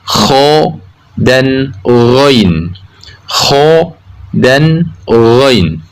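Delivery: kho and ghoin rasped at the top of the throat; upper-throat letters. Shown as a demonstration. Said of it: "Ujung tenggorokan keluar dua huruf".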